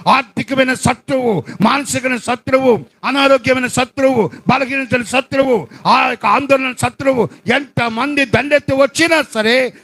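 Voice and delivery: high, rising preached plea; fervent preaching prayer. Acted. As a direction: cry out the prayer with building force. (ఉత్సాహంతో ప్రార్థన బోధ)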